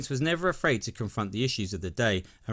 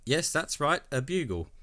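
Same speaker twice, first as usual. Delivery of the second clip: ordinary talk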